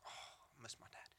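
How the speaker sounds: very soft, near-whispered longing; hushed retold sigh of missing someone; wistful aside. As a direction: breathe it out as a private thought. Retells his private grief as boy.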